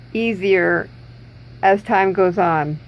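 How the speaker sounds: ordinary talk, nothing marked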